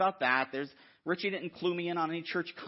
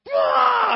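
a huge, high, blaring "Blah!"; mock-furious yell; riled outburst shout; 16 dB louder than usual. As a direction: blast it out like you've lost it. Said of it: "when I'm all riled up".

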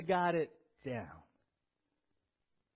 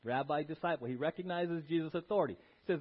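usual speaking voice

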